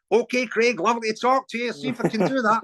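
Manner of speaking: high, hearty mimicked sign-off. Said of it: "That was a great impression as well".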